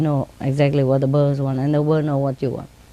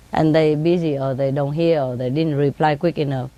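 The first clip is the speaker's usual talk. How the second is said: ordinary delivery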